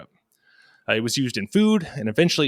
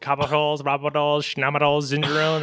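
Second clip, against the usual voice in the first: high, silly singsong listing of the made-up names; teasing his co-host by mangling the compound names in a high singsong; mock recitation; 3 semitones higher than usual. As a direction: rattle off the nonsense names like a chant.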